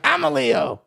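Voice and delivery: a prissy imitation of the girl; mock high-pitched brag; imitating her. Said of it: "She always be like".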